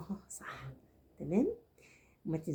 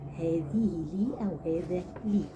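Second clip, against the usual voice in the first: هذه لي أو هذا لي spelled out slowly and louder; slow correct form; 4 dB louder, slower than usual. Shown as a demonstration. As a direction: give the correct phrase slowly. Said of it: "قل".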